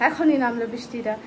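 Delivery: usual speaking voice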